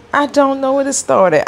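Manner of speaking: high squeal. Shown as a demonstration.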